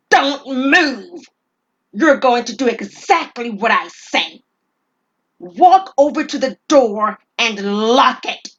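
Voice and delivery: loud, menacing robber bark; robber shouting. Acted. Gunman barking orders at the shop clerk, loud and menacing. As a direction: bark orders at her down a gun barrel.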